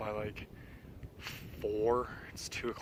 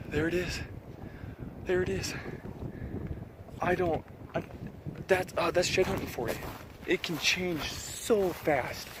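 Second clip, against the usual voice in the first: excited; 6 semitones higher than usual. Real, not acted. Finds another shed, higher pitch.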